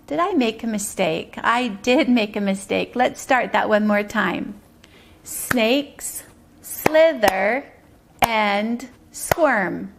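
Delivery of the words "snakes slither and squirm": "Snakes slither and squirm" very slow, on separate beats; syllable clapping. Shown as a demonstration. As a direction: pause between words, a beat on every syllable of "Snakes slither and squirm". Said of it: "Those are our syllables".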